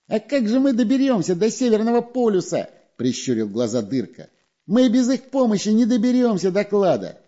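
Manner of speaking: high, squeaky little spy; high sneaky spy voice. A narrator's reading. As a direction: pitch him up like a sly weasel.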